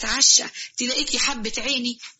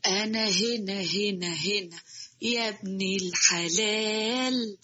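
soft, slow, far-off ghostly call; eerie distant call; 4 semitones lower, 7 dB quieter, much slower than usual. Acted. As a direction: beckon from far away, softly and slowly. Ghostly voice calls from nowhere.

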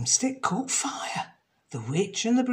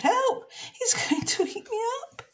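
much higher, laughing panic; a playful squeal of fear; mock fright. Jenny fears the crocodile again, high with laughter. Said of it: "Jenny thought".

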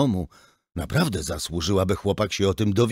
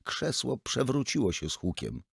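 plain reading voice

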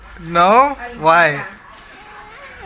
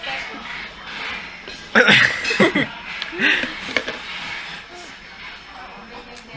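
silent wheezing laugh; 9 semitones higher than usual. Real, not acted. Watching little brother suffer sour.

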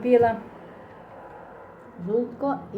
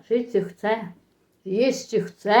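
usual speaking voice

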